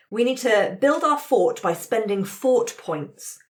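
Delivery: normal talking voice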